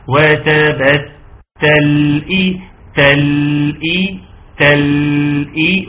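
slow وتبتل إي with the ل lightly held and flowing; medium-flow lam. Shown as a demonstration. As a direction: let the ل of «تل» run on before إي. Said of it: "بقول لكم التوسط بتاع اللام". (توسط اللام في «تل إي» ببطء)

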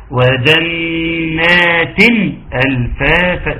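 وجنات ألفافا joined through the tan, the tanween running straight into ألفافا; linked with tan. Shown as a demonstration. Teacher shows the class how to join the two words. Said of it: "تتربط بتن".

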